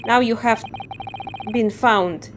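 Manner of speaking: put-on Russian accent. Guard threatens prisoner with the ocean, Russian accent. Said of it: "I assume he's Russian".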